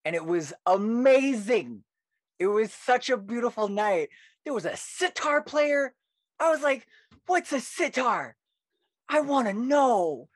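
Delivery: high, drawn-out wonder; gush, stretching the big words high and long; excited gushing. Real, not acted. Poet recalling a magical open mic, excitedly.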